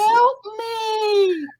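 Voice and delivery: put-on high, loud child's cry of help me; piercing high child's voice; little kid crying for help. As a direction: call out like a frightened little kid. Retold kid calling for help.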